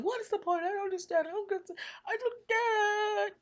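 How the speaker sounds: crying out to the Father, high and upset; upset, pleading. Retold or imitated. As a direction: wail it like a hurt child. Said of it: "I was really that mad".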